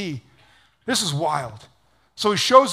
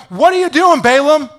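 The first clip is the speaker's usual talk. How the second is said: loud, high mock outrage; indignant talking donkey; 8 semitones higher, 9 dB louder than usual. Donkey looking up at Balaam who hit him, acted indignation. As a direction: cry out like an affronted animal.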